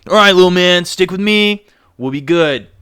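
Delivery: rally a little buddy; reassuring teammate. Acted. Cyclozar to his little partner, upbeat reassurance. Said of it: "he's like".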